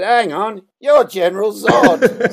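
sudden high, loud recognition, laughing; surprised. Retold or imitated. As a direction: blurt it out, suddenly recognising him. Surprised Australian recognising Zod.

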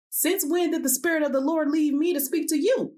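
high, indignant demand. A narrator's reading. Zedekiah confronting Micaiah after the slap, demanding. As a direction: challenge him with pitch held high. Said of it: "he demanded".

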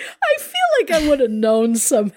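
high, half-laughing, half-crying; laughing through tears. Real, not acted. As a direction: giggle it out with a catch of tears. Teary laugh over sad story.

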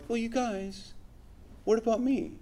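preacher mocking how Paul did not react, whiny; mock whiny complaint. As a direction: play a sulky child who wants the credit.

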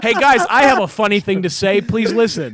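loud, high, mock-eager announcement; mocking imitation. Mimicking a friend who announces his jokes, mockingly loud. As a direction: imitate a friend begging for attention. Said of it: "That's the best way to preface a joke".

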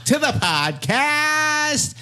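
slow, high, booming host; announcer voice. Pretend. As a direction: call out like a game-show host. Announcing the returning guest.

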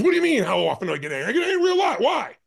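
quick, high, shouted angry retort; retold angry outburst. Angry woman answering the man questioning her, mock furious shout. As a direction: imitate an angry woman firing back, rising to a shout. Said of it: "talking so angrily".

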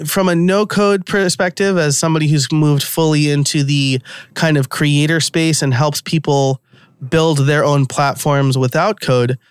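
usual speaking voice

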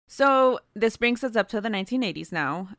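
ordinary talk, nothing marked

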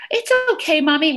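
high-pitched imitation of a forgiving little kid; forgiving child. Child forgiving mommy after an apology, sweet high kid voice. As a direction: play a small child readily forgiving, pitched well up. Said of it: "kids are so much more forgiving".

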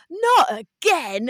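hammed-up old geezer lamenting the loss; dismayed old man. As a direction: stretch it out like a weary old fan who's seen it all before. Old man reacting to penalty loss.

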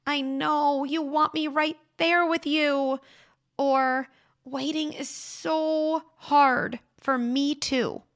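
put-on gently name the child's wish; validating a child. Shown as a demonstration. Parent validating a waiting child's frustration, tenderly. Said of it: "This means saying things like".

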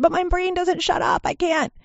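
high, loud, hammed-up complaint; mock frustrated protest. Imitating herself first trying to meditate, in exaggerated frustration. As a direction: wail it like an exasperated beginner.

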